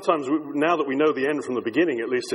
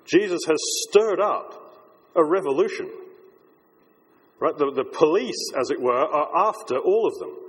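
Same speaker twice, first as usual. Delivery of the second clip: normal talking voice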